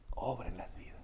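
soft whispered repeat of "Obra en las vidas"; whispered echo. Acted. (repetir "Obra en las vidas" susurrando)

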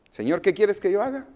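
loud, high, rising question; Paul asking. Acted. Preacher voices Paul asking the Lord for orders, calling out. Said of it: "porque le dice".

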